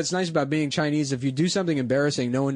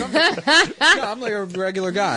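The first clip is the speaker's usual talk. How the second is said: high mock voice; 6 semitones higher, 4 dB louder than usual. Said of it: "He's like".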